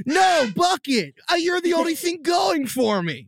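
high, hammed-up shout of despair; desperate pleading. Improvised breakup scene.

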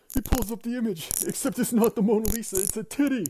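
high-pitched, hammed-up glee; gleeful reveal. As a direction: build to a scandalous punchline.

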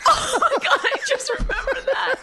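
high, breathless laugh through the words; giggling out the sudden memory. Real, not acted. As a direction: crack up as it hits you. Sudden memory cracks him up.

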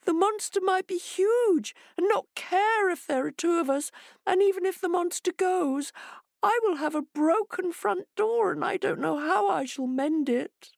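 very high, squeaky fretting; wailing hare. Acted. Hare objects to Jackal's plan, wailing. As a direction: fret in a tiny wailing voice. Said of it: "wailed Hare".

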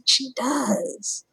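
dawning realization. Retold or imitated. Realizing friend does websites.

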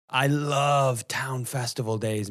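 ordinary talk

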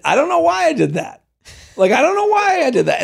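high, baffled mock excuse; imitating own excuse. Admitting her own old excuse, high baffled mimic. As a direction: play your past self, baffled and high. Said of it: "I've said it".

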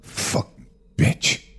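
low, slow muttered curse under his breath. Acted. Father turning his back on wife, muttered curse. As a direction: spit it out as you turn away.

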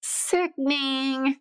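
drawn-out sickening. Real, not acted. Slow drawn-out praise.

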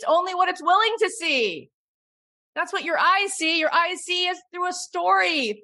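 high, emphatic, driving the contrast home; impassioned insistence. Real, not acted. Impassioned point about camera versus eyes.

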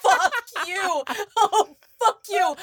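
high, laughing mock outrage. Real, not acted. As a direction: curse through helpless laughter. Laughing at a great pun.

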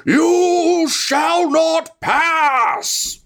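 booming thunder from the sky; magically amplified voice. A narrator's reading. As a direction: roar it down the mountain like a god. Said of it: "you hear a thunderous voice, um, that just says".

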